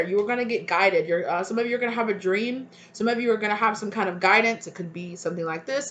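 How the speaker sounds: ordinary talk, nothing marked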